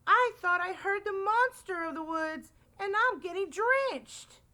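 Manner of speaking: high-pitched, urgent Rabbit voice; crying out. A narrator's reading. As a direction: cry it out in a high plea. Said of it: "cried Rabbit".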